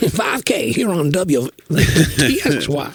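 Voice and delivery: slow radio ID voice; radio announcer. Pretend. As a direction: announce the station slowly, laughing. Mock radio station ID.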